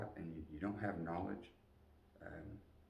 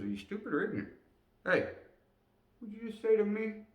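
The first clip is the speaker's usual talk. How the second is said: high, loud challenging sergeant voice; sergeant question; 5 dB louder than usual. Retold or imitated. Sergeant testing the private, then the private bristling, high and loud. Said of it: "He goes".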